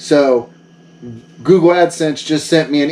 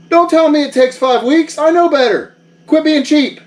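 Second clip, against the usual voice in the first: indignant haggling; 8 semitones higher than usual. Acted. Arguing shipping time with Yoshi.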